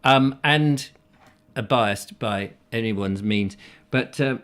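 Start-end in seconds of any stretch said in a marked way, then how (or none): none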